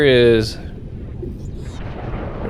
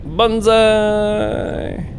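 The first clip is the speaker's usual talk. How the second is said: excited shout; 10 semitones higher than usual. Real, not acted. Jumping down the big drop.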